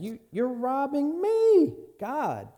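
"me" thrust out high and loud; emphatic God's voice. Acted. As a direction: accuse them as God, leaning on "me". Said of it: "that's what God's saying".

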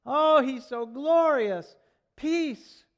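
very loud, high, drawn-out acclaim; exultant praise. Acted. Congregation come in to sing praise, exultant shout. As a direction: belt out the praise like a fervent congregation. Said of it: "us to come in here and sing".

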